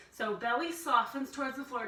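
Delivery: ordinary talk, nothing marked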